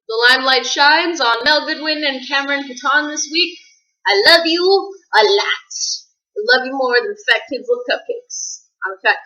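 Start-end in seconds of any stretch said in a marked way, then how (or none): 0.1-3.6 higher, bigger announcer delivery. Acted. Announcing the Limelight dedication. 4.1-5.7 higher, louder and slow, drawn out; drawn-out loud declaration. Acted. Declaring love to friends.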